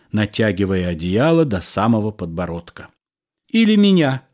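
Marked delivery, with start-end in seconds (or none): 3.5-4.2 sharp, higher outburst from the wife; flashing anger. A narrator's reading. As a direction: fling it back at him, eyes blazing. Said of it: "сверкнула глазами жена".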